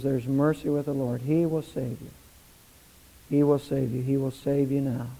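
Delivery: low, soft, hushed invitation; quiet pastoral appeal; prayerful altar call. Real, not acted. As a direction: gently invite them to pray, with long pauses.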